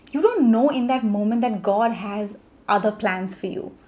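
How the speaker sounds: normal talking voice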